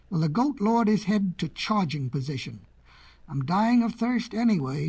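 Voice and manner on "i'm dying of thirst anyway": usual speaking voice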